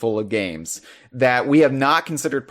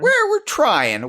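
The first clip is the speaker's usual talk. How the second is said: high, slow drawn-out whine; 3 dB louder, slower than usual. Acted. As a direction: stretch it out with mock effort, much higher. Sighing comment on the timetable.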